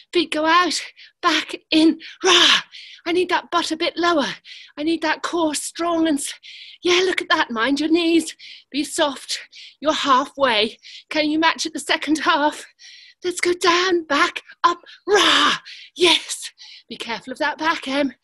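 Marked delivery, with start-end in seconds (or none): 2.2-2.6 lion roar shout. Shown as a demonstration. Class lion roar on cue. 15.1-15.6 lion roar shout. Shown as a demonstration. Class lion roar on cue.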